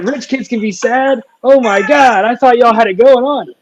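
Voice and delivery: high, loud, hammed-up disbelief; mock shock. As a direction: play the gasping, exaggerated outsider, sky-high and loud. Mock shocked reaction, retold.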